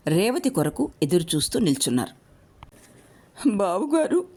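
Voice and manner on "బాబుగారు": much higher, tearful plea; pleading cry to the master; almost crying. A narrator's reading. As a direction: call out on the edge of tears. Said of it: "దాదాపు ఏడుస్తూనే పిలిచింది రేవతి".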